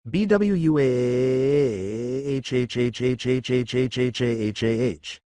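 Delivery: drawn-out comic wail, very slow; mock weeping wail, stretched very slowly; slow hammed-up wail. A narrator's reading. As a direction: let a long sobbing wail trail off. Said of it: "wailed Marilyn".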